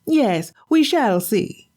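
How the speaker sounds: high, nagging wife's voice; scolding wife. Acted. Wife scolding her husband for an empty catch.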